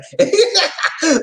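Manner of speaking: high burst of real laughter; high giggling laugh. Real, not acted. Laughing at the hotel story, a real high cackle.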